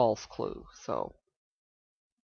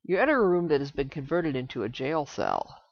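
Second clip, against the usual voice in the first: reading game text; 7 dB louder than usual. Acted. Reads game text aloud.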